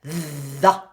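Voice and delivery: "The" with a buzzing voiced th. Shown as a demonstration. Coach models voiced th in the. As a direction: buzz the th of "The". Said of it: "And open your vowel".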